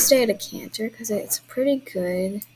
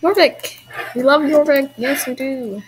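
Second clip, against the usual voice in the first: loud, high cheer, easing at the end; excited cheer; 4 semitones higher, 8 dB louder than usual. Real, not acted. Player cheering for Jorvik, excited and loud. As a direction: cheer it out like a fan.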